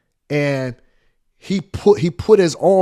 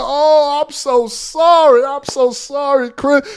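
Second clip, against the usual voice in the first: higher, slow, apologetic bishop; lightly voiced bishop begging pardon; mock sorry bishop; 9 semitones higher, slower than usual. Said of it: "He said".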